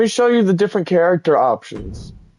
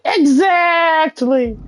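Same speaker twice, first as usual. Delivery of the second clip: slow high-pitched whine; high wail; 13 semitones higher, much slower than usual. Acted.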